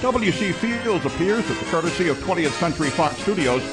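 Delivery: ordinary delivery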